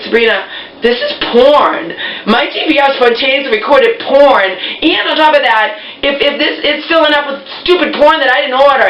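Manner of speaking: high, exasperated retelling of the complaint; indignant complaint. Retold or imitated. Insisting to Sabrina the DVR recorded porn, high and exasperated. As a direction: spell it out, fed up but amused.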